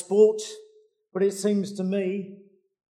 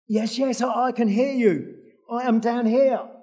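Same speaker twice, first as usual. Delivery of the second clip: higher, strained call up the cliff; desperate reply; 6 semitones higher, 5 dB louder than usual. Acted. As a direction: answer upward, clinging on. Jack calls up desperately.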